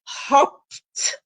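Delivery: "Hopped" whispered, p held back and unreleased; teacher whispering "Hopped" as an example; whispered demo word. Shown as a demonstration. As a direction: breathe "Hopped" without voice, stopping the air at the p. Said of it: "the P is under release".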